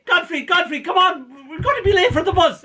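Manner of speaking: high, hurried call to a dawdler; urgent calling. Retold or imitated. Friend trying to get Godfrey out of the house, urgent call. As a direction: call out to hurry him along.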